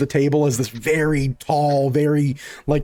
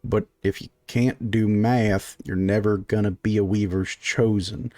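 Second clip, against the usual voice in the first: slow, lower, deliberate butting in; slow interjection; 5 semitones lower than usual. Acted. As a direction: drag each word out as he cuts in. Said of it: "Clyde slowly butt in".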